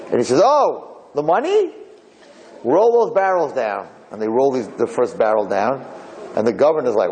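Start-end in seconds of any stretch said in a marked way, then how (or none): 0.4-3.9 high, grand announcement, slowing on the order; proud reveal. Acted. Merchant tells the welcoming crowd, proud and grand. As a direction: show off the treasure to the crowd. Returning merchant shows barrels.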